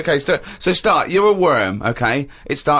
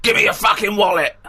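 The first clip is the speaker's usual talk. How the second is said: shouting; 4 semitones higher than usual. Pretend. Mock mugger shouting in park.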